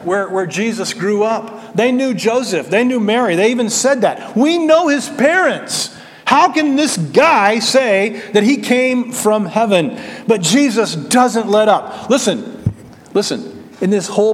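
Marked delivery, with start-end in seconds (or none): 4.4-10.0 higher, slow, incredulous scoff as the skeptical townsfolk; preacher plays the grumbling crowd, voice raised in disbelief; mocking grumbling crowd. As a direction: sneer it like neighbours who knew him as a boy.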